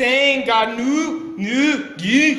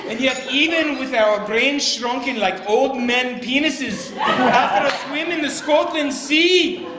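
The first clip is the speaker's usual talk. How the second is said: wandering, muddled Scottish brogue; hammed-up mongrel Scottish accent for laughs; terrible Scottish brogue; 4 semitones higher than usual. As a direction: toast in a badly faked Scottish accent. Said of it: "my Scottish brogue, ... now sounds so terrible".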